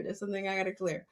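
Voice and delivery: soft fast aside to herself; quiet to herself. Real, not acted. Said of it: "I gotta clear that".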